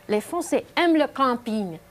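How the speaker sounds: normal talking voice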